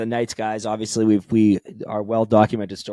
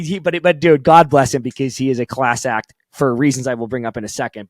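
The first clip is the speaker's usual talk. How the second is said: normal talking voice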